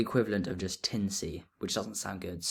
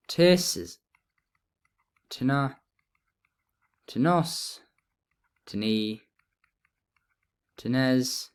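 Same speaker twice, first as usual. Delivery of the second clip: reciting paradigm; slower than usual. Shown as a demonstration. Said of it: "recites the declension".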